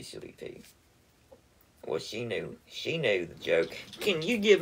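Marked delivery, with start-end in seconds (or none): 0.0-0.6 whispered pet name. Acted. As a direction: breathe it out as a whisper. Tender goodbye to prank target.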